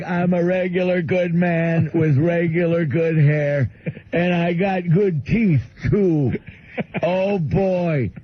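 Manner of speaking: put-on drawn-out, proud caricature of a simple old man; Bill. Bill proudly describing his good hair and teeth, slow high caricature. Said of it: "He's like".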